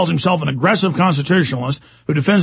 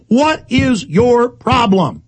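higher and drawn out, very slow; furious, slow rhetorical question; exasperated outburst; 6 semitones higher, much slower than usual. Real, not acted. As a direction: demand it in disbelief. Ranting at a threatening poster.

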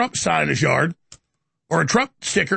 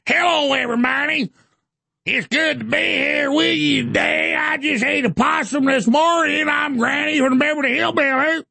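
Hillary Clinton; 8 semitones higher than usual. Acted. Host mocking a politician in Kentucky, high hillbilly accent. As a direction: shout it like a mock Granny from Kentucky. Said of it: "Hillary when she goes to Kentucky".

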